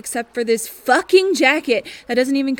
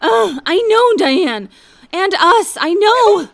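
loud anguished cries; distressed groaning; 6 semitones higher, 6 dB louder than usual. Acted. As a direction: cry out in dismay. Panicked reaction to attack.

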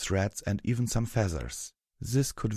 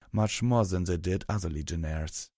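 usual speaking voice

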